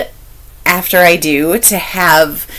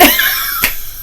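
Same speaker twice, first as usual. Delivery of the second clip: high squeaky giggle; high embarrassed giggle; squeaky laugh; 4 dB quieter than usual. Real, not acted. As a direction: let out a tiny high squeal.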